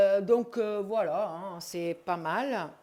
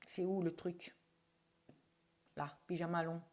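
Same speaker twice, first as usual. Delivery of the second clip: whisper; 4 semitones lower, 10 dB quieter than usual. Real, not acted. Searching for the label, aside.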